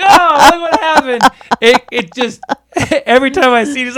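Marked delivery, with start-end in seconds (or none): 0.0-1.2 high, loud, drawn-out imitation; hammed-up slow wail of feigned surprise; mock dismay. Mimicking film character tossing gun.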